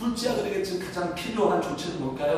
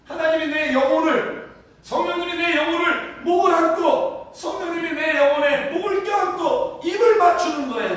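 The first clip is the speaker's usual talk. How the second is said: rising, louder impassioned preaching; fervent preaching; 6 semitones higher, 4 dB louder than usual. Acted. Pastor to the congregation, about the Spirit's embrace, in fervent preaching. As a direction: preach it to the congregation with growing fervour.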